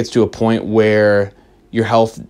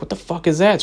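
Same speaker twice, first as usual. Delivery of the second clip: a sudden high pitched jolt of surprise; startled; 8 semitones higher than usual. Retold or imitated. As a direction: react to a scream out of nowhere. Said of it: "I was like".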